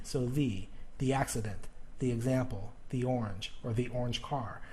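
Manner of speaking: softly repeated list, every "the" said thee; long E in "the", rhyming with see. Shown as a demonstration. Teacher repeating vowel examples softly, each "the" as thee. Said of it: "it sounds like see".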